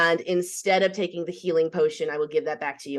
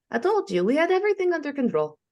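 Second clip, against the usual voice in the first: smug high voice; 10 semitones higher, faster than usual. Acted. Character boasting after rescue.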